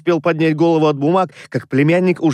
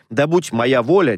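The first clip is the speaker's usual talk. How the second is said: usual speaking voice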